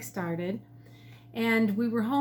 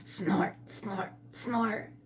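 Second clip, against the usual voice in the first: deer snort; 5 dB quieter, much slower than usual. A narrator's reading. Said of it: "A deer answered his call".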